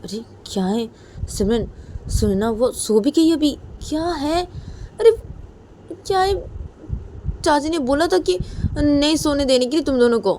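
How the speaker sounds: put-on high, loud, nagging teen-girl voice; whiny teasing cousin. Cousin nagging the others to stay awake, high whiny voice.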